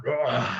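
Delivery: big roar of a grown lion; Mufasa's roar. Retold or imitated. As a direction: roar like the mighty father lion. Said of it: "And then Mufasa comes and he's like".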